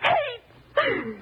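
very high, tearful cry of hate; hateful outburst. Acted. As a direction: hurl the word out in anguish. Spits out her hatred of him.

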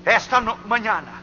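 impassioned pulpit exhortation; forceful preaching appeal. Real, not acted.

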